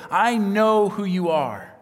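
loud, high, drawn-out accusation; retold scream. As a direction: imitate a demon calling someone out, slowly. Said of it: "he screams out".

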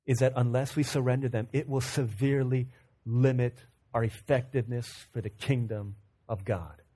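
low, soft, grave delivery; hushed, measured sermon point; solemn preaching. Real, not acted. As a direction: let each phrase land quietly with long pauses.

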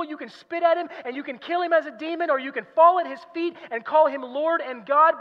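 high-pitched, driving sermon delivery; forceful preaching. Acted. Preacher pressing Lewis's argument on the congregation, forceful preaching. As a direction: drive the argument home from the pulpit.

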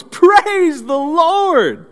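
put-on old lady shouting high and slow to the whole street; yelled praise. Old lady calls to the neighborhood, high slow yell. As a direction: ring it out like a joyful old lady on her porch. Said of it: "she yells".

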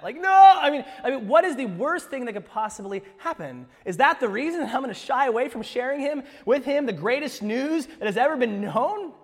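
loud, high, incredulous challenge; impassioned preaching. Real, not acted. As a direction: press the question home at full voice.

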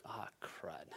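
low, whispered aside of dread; hushed dismay. Retold or imitated. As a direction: say it under your breath, sinking. Retold moment of starting to slip.

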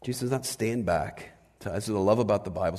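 ordinary talk, nothing marked

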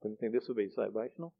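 soft, quick, choked-up apology; real emotion, apologising softly; teary apology. Real, not acted. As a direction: push through tears with a little laugh. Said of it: "sorry guys".

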